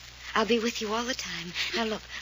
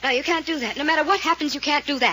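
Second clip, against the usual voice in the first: desperate plea; 6 semitones higher, 8 dB louder than usual. Acted. Fay begs him not to.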